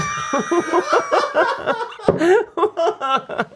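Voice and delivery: high, long peal of laughter; helpless laughter. Real, not acted. Podcaster cracking up at own remark, helpless laughter. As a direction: lose it completely, laughing high.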